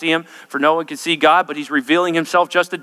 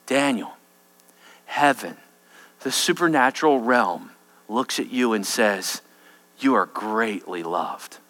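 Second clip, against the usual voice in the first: tender; 7 semitones lower, 5 dB quieter than usual. Acted. Preacher gently tells Daniel he is loved, softly. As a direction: speak it like a loving blessing.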